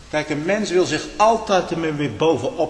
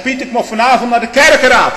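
quoting Bas; 9 semitones higher, 6 dB louder than usual. Retold or imitated. Bas announces he goes to council.

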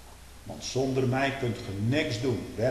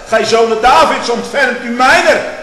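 crying out; 13 semitones higher, 18 dB louder than usual. Acted. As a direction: scream the plea after him. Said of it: "Schreeuw hem dan achterna". (smekend uitgeschreeuwd)

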